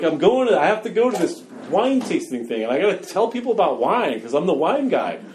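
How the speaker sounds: mock-panicked wine guy pleading; panicky. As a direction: retell the panic to your sponsor, keyed up. Panicked retold plea to sponsor.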